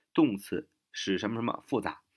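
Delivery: usual speaking voice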